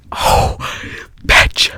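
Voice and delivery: a loud fed-up Oh bitch, half-joking; mock exasperated outburst. SpongeBob fed up with Patrick's excuse, loud mock outburst. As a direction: blurt it out loud like SpongeBob losing patience.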